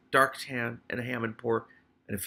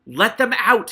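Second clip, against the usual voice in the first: much louder, higher rat scream; 10 semitones higher, 11 dB louder, slower than usual. Acted. Hammond Pork screaming at Keith to free the rats. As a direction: scream it at full rage. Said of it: "Hammond Pork screamed".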